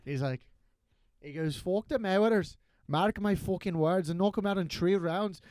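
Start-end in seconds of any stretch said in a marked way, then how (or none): 1.5-5.4 loud Irish brawler taunt, louder at the end; trash-talk like McGregor at a press conference; McGregor impression. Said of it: "do the McGregor thing".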